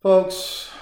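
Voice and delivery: host calling out to his audience, loud. Real, not acted. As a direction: grab the listeners with a loud call. Calling audience back to attention.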